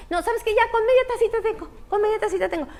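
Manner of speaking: high and rushed, eager to leave; hurried visitor. Retold or imitated. Neighbour backing out of an awkward kitchen, high and quick. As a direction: get out of there fast.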